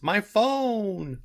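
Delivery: very high, drawn-out cry of dismay; hammed-up lament over a lost treasure; mock wail. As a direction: wail it high and stretch the last word. Crowther mourns his phone, acted.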